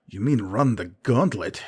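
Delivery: ordinary delivery, nothing marked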